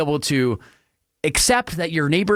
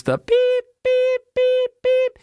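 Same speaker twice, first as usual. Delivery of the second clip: high flat beeping noise, much higher; bulldozer beep imitation; slower than usual. Host mimicking bulldozer reversing, high flat beeps.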